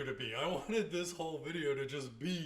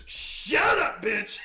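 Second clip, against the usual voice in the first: much higher, loud mock yell; 11 semitones higher, 12 dB louder, much slower than usual. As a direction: shout it back at her like you're on the dock. Yelling back at the boat lady.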